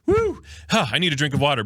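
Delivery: usual speaking voice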